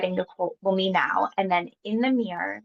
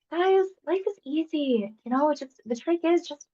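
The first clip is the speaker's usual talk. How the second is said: free-spirited grandma; 8 semitones higher than usual. Retold or imitated. Imagined grandma on the porch advising her boys, carefree and high. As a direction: play a breezy grandma handing out wisdom. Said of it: "I'm being this, like, free-spirited grandma".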